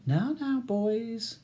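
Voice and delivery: high, lightly scolding lilt; woman's soothing voice. A narrator's reading. Woman barrister breaking up two quarrelling men, soothing. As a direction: gently chide them in a high voice. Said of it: "says a new voice".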